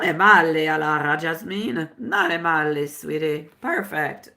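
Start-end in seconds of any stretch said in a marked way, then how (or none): none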